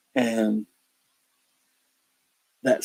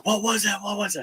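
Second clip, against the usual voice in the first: startled question; 10 semitones higher than usual. Retold or imitated. Others startled by the noise.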